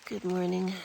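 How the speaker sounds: reluctant, ironic greeting; grudging good morning. Real, not acted. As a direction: say it knowing it's not good. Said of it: "I use that term loosely".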